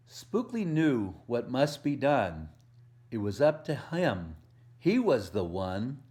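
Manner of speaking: lively picture-book read-aloud with a bouncy rhythm; reading to children. A narrator's reading. Volunteer reading a Halloween book to kids, storytelling voice.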